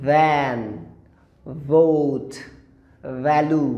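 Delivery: ভ্যান, ভোট, ভ্যালু with buzzing English v; English V words. Shown as a demonstration. Teacher giving students example words, carefully buzzed English v. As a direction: start ভ্যান, ভোট, ভ্যালু teeth on lip. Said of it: "উপরের দাঁত এবং নিচের ঠোঁট".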